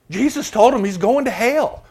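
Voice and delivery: high, loud mock proclamation; loud, high-voiced comic outburst; exclaiming. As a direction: pitch it way up and blurt it loudly. Preacher mock-proclaims a blunt verdict.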